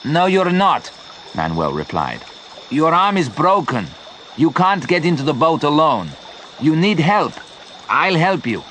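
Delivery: high, firm and caring; firm refusal. A narrator's reading. Manuel refusing to let injured Leon go last, firm. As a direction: overrule him kindly but firmly.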